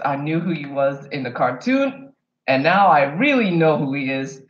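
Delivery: ordinary talk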